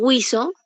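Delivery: "guiso" as gwee-so, as if written with a diaeresis; U sounded. Shown as a demonstration. Teacher supposing a diaeresis, "guiso" said gwee-so. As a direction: make the U audible in "guiso", gwee-so. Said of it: "con diéresis".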